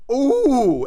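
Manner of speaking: sudden fright; startled ooh. Retold or imitated. Brother realizing the driver is drunk, high and alarmed.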